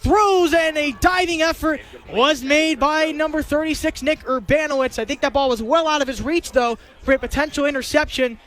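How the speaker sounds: ordinary talk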